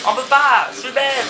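loud, high-pitched self-reproach; high, exasperated yell; exasperated outburst. Real, not acted. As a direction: cry out at your own blunder. Exclaims at own mistake in game.

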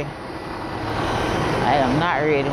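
"I am not ready" stretched out word by word; slow right down to insist on "not ready"; slow, emphatic restatement. Real, not acted. Weary traveler telling viewers she isn't ready, slow and emphatic.

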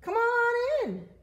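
high, loud coaxing call; excited Cow urging someone in; eager Cow. Acted. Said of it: "said Cow".

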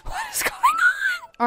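very high, drawn-out squeal; squealed bafflement. Pretend. Player baffled by what happens on screen, squealed bafflement. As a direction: squeak it out in comic disbelief.

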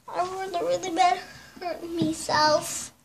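high, small pained voice; pretend hurt. Toy character has just hurt themselves, pretend hurt. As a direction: whimper it softly, playing hurt.

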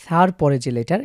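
normal talking voice

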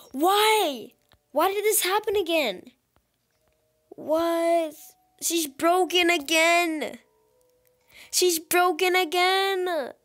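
loud, high-pitched frustrated wail; high, loud gamer meltdown; exasperated outcry. Real, not acted. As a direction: cry out in disbelief at the game. Frustrated that character is broken again.

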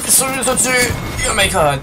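high, loud panicked yell; panicked shout. Real, not acted. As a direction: shout in alarm at the enemy. Enemies above, panic.

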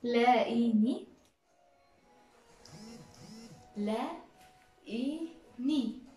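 very slow stretched لاقيني; slow syllable-by-syllable. Shown as a demonstration. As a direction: drag out each sound of the word. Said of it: "معرفش معناه إيه".

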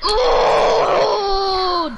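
long, high "ooh"; loud sustained wail of reaction; drawn-out cry. Real, not acted. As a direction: holler it out long. Reacting loudly to play.

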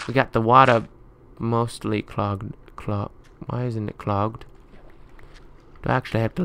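usual speaking voice

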